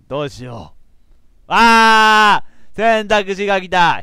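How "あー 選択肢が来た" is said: loud, high, drawn-out cry of excitement; thrilled gamer yelling; excited shout. Real, not acted. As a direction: shout it out, stretching the words. Game choice finally appears.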